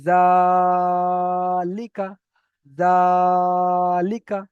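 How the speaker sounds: জালিকা with জা held long and loud, লিকা short; sustained জা on one pitch in জালিকা, no stretch on লি or কা; long ZA, short likā. Shown as a demonstration. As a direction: stretch the first syllable of জালিকা, then release লিকা briefly. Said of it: "জাটার সঙ্গে জুড়ে দিতে হবে".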